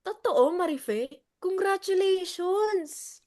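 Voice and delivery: slow, loud, high thrilled cheer; excited congratulations. Acted. To Marife about her new boyfriend, gushing cheer. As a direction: gush over her news.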